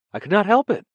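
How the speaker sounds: high, loud defensive cry; near-indignant protest. Acted. Ampleforth protests to Winston, high and almost indignant. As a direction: insist it wasn't his fault. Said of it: "he added almost indignantly".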